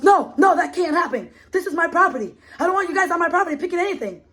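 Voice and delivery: fast, high mock angry man, with a laugh; hammed-up furious landowner, half laughing. Retelling the landowner refusing her, fast high mock anger.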